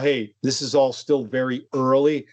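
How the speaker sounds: usual speaking voice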